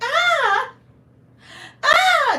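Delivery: two loud falsetto shrieks, mimicked; imitated high-pitched scream. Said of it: "His voice went up five".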